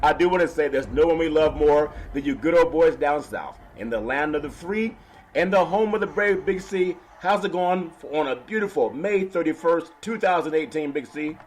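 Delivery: usual speaking voice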